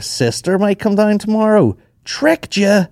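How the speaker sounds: high, drawn-out mock fib; put-on high voice. Guest fibbing to the host about visitors, in a high mock voice. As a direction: pitch it right up and stretch it out.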